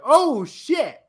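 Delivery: high-pitched re-enacted shock, not full volume; reliving a stunned outburst, pitch shooting up; high mock scream of disbelief; retold scream. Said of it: "when I tell you I screamed".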